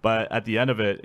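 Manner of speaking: normal talking voice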